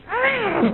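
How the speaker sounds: sudden high yowl from the cat; cat shriek. Retold or imitated. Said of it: "The cat goes".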